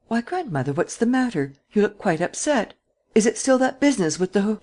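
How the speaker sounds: put-on young granddaughter surprised at grandmother's face; cheerful young woman. Young Geneviève voiced.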